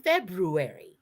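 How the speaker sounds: February as FEB-roo-air-ee; careful pronunciation. Shown as a demonstration. Cohost pleading with listeners, every letter of February pronounced. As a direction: sound the R after the B in February. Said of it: "please say library".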